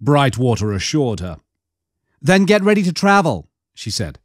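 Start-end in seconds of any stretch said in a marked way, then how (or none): 2.2-3.5 higher, brisk orders from a woman; commanding woman. Acted. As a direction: give orders without debate. Mara orders, much higher.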